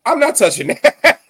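high, loud laughing refusal. Real, not acted. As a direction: laugh while you refuse.

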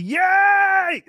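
high, drawn-out shouted yay; cheering. Pretend. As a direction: let out a long, loud cheer. Cheering a donation.

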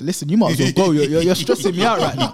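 loud re-enacted telling-off; retold exasperation at full volume; exasperated retort. Re-enacted row with hostile woman.

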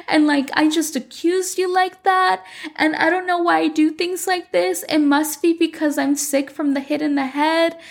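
high, put-on whimpering victim voice; fake crying Paola. The manipulative sister on the phone, mock sobbing. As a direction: ham up a tearful self-pity act. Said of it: "She's just pretending to be crying".

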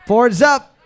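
loud, high quizmaster call. Real, not acted. Host calls time to teams.

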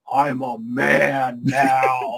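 very slow, deepened man voice; newly manly nerd. Retold or imitated. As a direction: drop low and stretch every word proudly. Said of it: "He hit puberty".

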